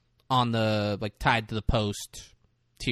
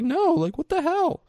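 much higher, exasperated mock protest; upset mother. Tearful mother to her sister on phone, mock upset. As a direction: imitate an upset mom's high-pitched complaint. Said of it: "Kitty just starts crying".

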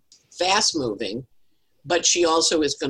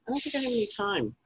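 high, quieter fretful repeat; fretting, a bit softer; 8 semitones higher, 6 dB quieter, faster than usual. Retold or imitated. Repeating her worry while cooking, high and softer. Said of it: "And I'm like".

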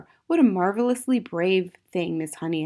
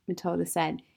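plain reading voice

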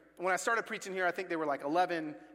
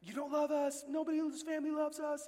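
imitating screaming son; 7 semitones higher than usual. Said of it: "He's in the room screaming".

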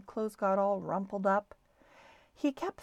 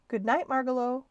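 called out a bit louder; calling good night; 4 semitones higher, 6 dB louder than usual. A narrator's reading. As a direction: call good night after the bird as she hops off. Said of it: "called Stuart".

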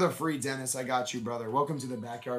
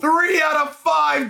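excited shout; 7 semitones higher than usual. Real, not acted. Host shouting the serial number to the viewers. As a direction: announce it with a triumphant yell.

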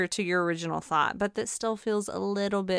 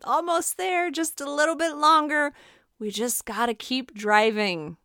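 put-on high, coaxing parent to a child; calm an impatient kid down; soothing parent; 6 semitones higher than usual. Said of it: "what do you say to a kid in the back seat".

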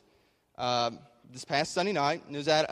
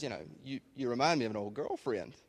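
lightly voiced low, soft male actor; a hint of a laid-back film star; Jack Nicholson; 4 semitones lower, 6 dB quieter than usual. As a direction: murmur it low, a casual come-on. Voicing Jack Nicholson's sly come-on.

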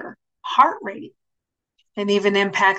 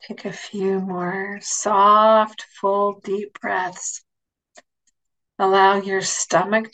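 guided meditation. Acted. Leads a guided imagery meditation.

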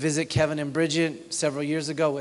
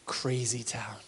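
whispered hush; 4 semitones lower, 7 dB quieter, slower than usual. Real, not acted. Preacher building suspense before the miracle, whispered. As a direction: breathe it like a secret.

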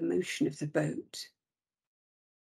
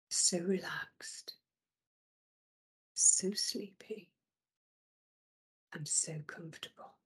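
sleepy whisper; 5 dB quieter than usual. Acted. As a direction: let each phrase sink toward sleep.

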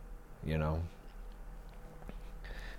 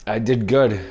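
proud; 5 semitones higher, 11 dB louder than usual. Real, not acted. Proud boast about dodgeball.

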